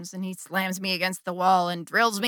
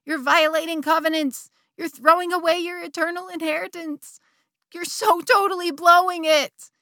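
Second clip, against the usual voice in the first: higher, shrill-toned scolding conscience; angry little voice; 8 semitones higher, 5 dB louder than usual. Retold or imitated. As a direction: scold herself like a panicked inner voice. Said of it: "angry little voice ... was screaming".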